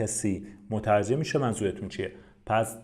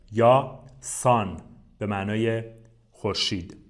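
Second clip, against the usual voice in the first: ordinary delivery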